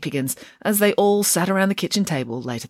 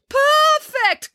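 loud, much higher call of delight; 5 dB louder than usual. Acted. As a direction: shout it across the bleachers. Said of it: "called Boris".

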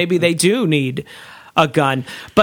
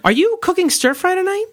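mock high voice; 10 semitones higher than usual. Mock voice bit.

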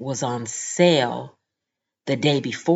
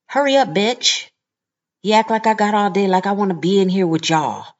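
higher, louder rude command; impatient snapping; 5 semitones higher, 4 dB louder than usual. Retold or imitated. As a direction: rush the cashier like she's wasting your time. Lady snapping at silent cashier.

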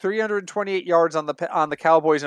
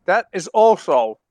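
ice in mouth; 4 dB louder, slower than usual. Real, not acted. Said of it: "sorry, ice cube in my mouth".

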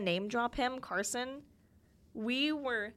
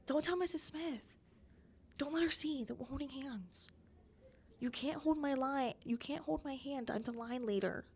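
put-on hushed kid warning; 3 semitones higher, 7 dB quieter than usual. Kids hiding hand-holding from teacher.

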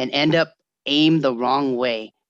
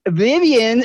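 higher, louder sing-song call; teacher calling out a name, raised and bright; 9 semitones higher, 6 dB louder than usual. Retold or imitated. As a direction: announce her name to the class. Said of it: "they're all".

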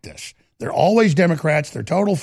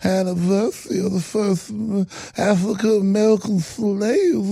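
relishing mockery; 2 semitones higher, 3 dB quieter than usual. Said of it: "he just relishes".

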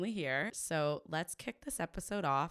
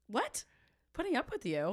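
high-pitched incredulous questioning; rising, surprised objection; mock disbelief; 7 semitones higher, 5 dB louder than usual. Real, not acted. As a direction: playfully protest a friend's modesty. Host playfully objects to guest's self-deprecation.

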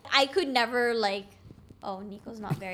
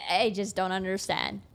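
ordinary delivery

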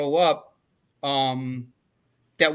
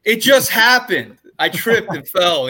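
loud, high, drawn-out mock protest; hammed-up innocent explanation; mock excuse; 6 semitones higher, 10 dB louder, slower than usual. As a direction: play up a silly excuse, slowly and loudly. Jokingly acting out an excuse.

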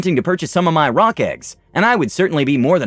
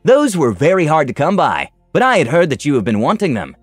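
lightly voiced flattering merchant, raised pitch; 4 semitones higher than usual. A narrator's reading. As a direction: sell it with a touch of flattery. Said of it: "he said in a flattering tone".

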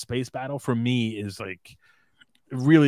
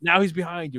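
raised pitch, retold warning; retold pirate alarm; 7 semitones higher than usual. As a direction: lightly voice a pirate losing track of his foe. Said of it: "the pirate that runs away is like".

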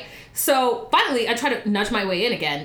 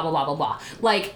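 usual speaking voice

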